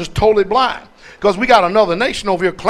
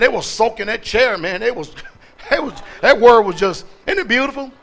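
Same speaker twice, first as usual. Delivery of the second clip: ordinary talk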